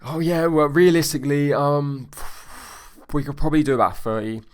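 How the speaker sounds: upbeat, smiling voice. Acted. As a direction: act a cheerful client thinking out loud. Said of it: "I want them to smile".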